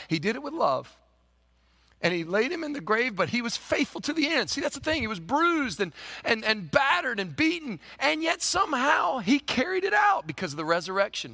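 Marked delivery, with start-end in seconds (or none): 2.0-11.3 rising, loud preacher's build; preaching at full force. Acted. Preacher building to a shout before his congregation. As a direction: build the sermon to a shouting peak.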